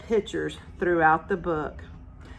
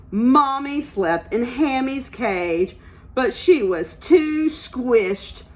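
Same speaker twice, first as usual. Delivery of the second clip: slow, expressive storybook reading; picture book read-aloud; reading to children; 5 semitones higher than usual. Acted. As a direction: read it like story time for little ones. Teacher reads picture book aloud.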